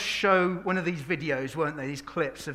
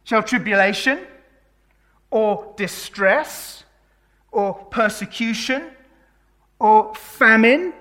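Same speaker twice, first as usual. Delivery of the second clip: rising, ever louder preaching, each word slow and weighted; full-force preaching; 2 semitones higher, 9 dB louder, slower than usual. Acted. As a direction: build the list up like a call to the church. Preaching Romans eight at full force.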